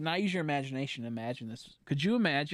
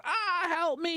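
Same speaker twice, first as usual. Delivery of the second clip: mock cry for help; slower than usual. Imagined trapped in Snorlax tummy.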